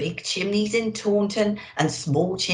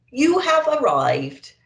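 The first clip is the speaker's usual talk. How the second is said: higher, louder, slow robotic announcement; sat-nav voice; 8 semitones higher, 6 dB louder, slower than usual. A narrator's reading. Santa Nav announcing arrival, slow and mechanical. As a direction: read it like a deadpan navigation device. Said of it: "said the Santa Nav unhelpfully".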